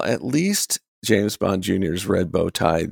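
ordinary talk

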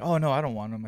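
mildly acted angry refusal; retold mad customer. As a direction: refuse loudly, imitating an annoyed customer. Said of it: "She's all mad".

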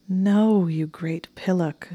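affectionately annoyed, stretch out the insult; annoyed moan. A narrator's reading. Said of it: "she said with an annoyed moan".

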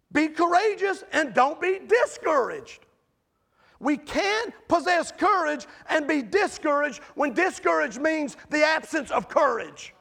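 fiery pulpit delivery, climbing to a shout; full-force preaching. Acted. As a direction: preach it hard to the congregation.

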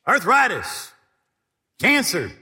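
loud commanding address to sickness. Shown as a demonstration. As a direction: call them out by name. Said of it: "Yeah, say".

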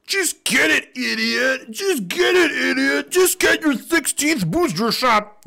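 high mock-scolding put-on voice; high, slow mocking order. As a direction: sneer it as the bossy brother. Mocking the trucker's brother.

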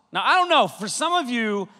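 ordinary delivery

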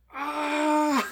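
un grito agudo y muy fuerte, fingiendo dolor; grito de dolor fingido. Acted. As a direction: grita como si te azotaran. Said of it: "Empieza a gritar de dolor". (el americano grita de dolor con el latigazo, agudo y fuerte)

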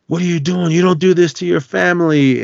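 high, loud horrified shout; shocked angry outburst. Retold or imitated. Eben yelling at the deputy who killed his family, horrified shout. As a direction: yell at him in disbelief.

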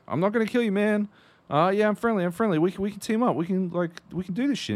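high, loud friendly pitch; hammed-up eager offer of alliance; eager retold reassurance. Gamer offers scared stranger a team-up, eager high-pitched reassurance. Voices own reply to scared player.

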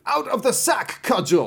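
high, loud command to the cudgel; spring the trap with a ringing cry; cried out. A narrator's reading. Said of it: "he cried".

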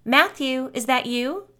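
high questioning call, rising at the end. A narrator's reading. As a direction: call out to see who is there. Calling out to unseen friend.

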